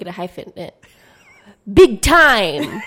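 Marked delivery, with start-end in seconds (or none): 1.7-2.8 loud, high big time; excited shout. Real, not acted. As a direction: belt it out happily. Exuberant answer.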